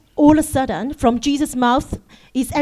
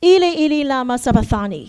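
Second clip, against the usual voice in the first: ordinary delivery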